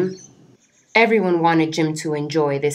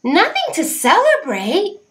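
disbelieving; 13 semitones higher, 3 dB louder than usual. Acted. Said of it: "Oxpecker couldn't believe it".

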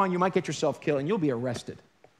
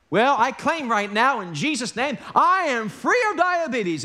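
much higher, louder, slow mock proclamation; mock bold claim; 6 semitones higher, 5 dB louder, slower than usual. Preacher mocking a diabetic who throws out pills, in a high, loud, slow mock proclamation. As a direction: ham up a showy faith claim, slow and loud. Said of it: "let's suppose you're a diabetic".

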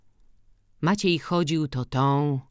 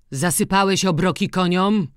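gruff question raised higher and louder; old farmer asking; 6 semitones higher, 7 dB louder than usual. A narrator's reading. Old farmer questions his farmhand about feeding the horses. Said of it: "zapytał Kuby".

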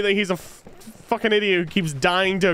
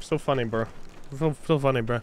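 sarcastic; 5 semitones lower, 6 dB quieter than usual. Pretend. Sarcastic mock praise.

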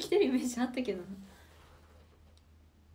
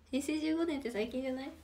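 ordinary delivery

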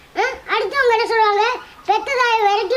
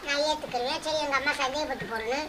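much softer, nearly under her breath; low resigned voice; 4 semitones lower, 12 dB quieter than usual. Real, not acted. Daughter answering her mother in a hushed, resigned voice. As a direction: give in quietly, barely audible.

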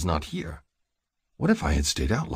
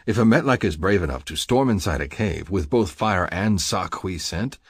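plain reading voice

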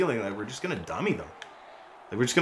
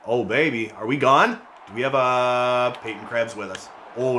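ordinary talk, nothing marked

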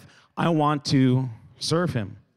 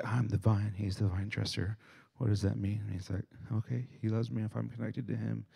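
muttering under breath; 2 semitones lower, 10 dB quieter than usual. Retold or imitated. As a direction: think it through aloud in a murmur. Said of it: "to mutter under your breath".

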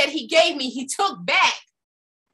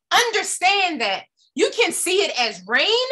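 normal talking voice

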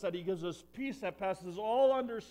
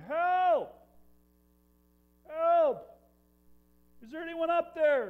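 desperate high, loud shout for help; hollering for help; 13 semitones higher, 11 dB louder, slower than usual. Acted. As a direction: scream up the canyon like a man dangling from a branch. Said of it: "he was hollering".

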